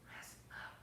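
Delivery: unvoiced whisper of rest up. Shown as a demonstration. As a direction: breathe the words out with no voice. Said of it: "but I'm whispering".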